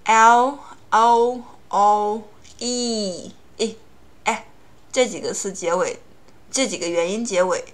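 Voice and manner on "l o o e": L O O E read very slowly, letter by letter; teacher spelling letters; slow spelled-out letters. Shown as a demonstration. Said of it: "如果前面一个单词是以".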